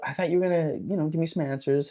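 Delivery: high-pitched puzzled protest; retold complaint. Seeker to vanishing spirit who owes answers, complaining.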